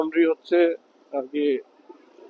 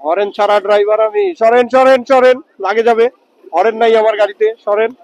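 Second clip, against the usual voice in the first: loud shout, get out of the way; shouting to clear way; 5 semitones higher, 8 dB louder than usual. Real, not acted. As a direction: yell it over traffic. Yelling at people to move.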